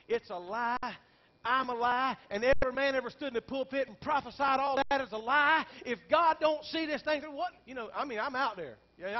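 high, loud preacher's cry; preaching at full force. Acted. Pastor tells congregation his despair, loud preaching cry. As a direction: lift the congregation with it.

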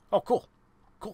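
delighted little critter; excited squirrel. Acted. Squirrel reacts happily.

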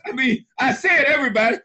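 ordinary talk

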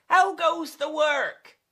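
high, loud farmer's call. Acted. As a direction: holler it as the farmer. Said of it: "expecting to hear".

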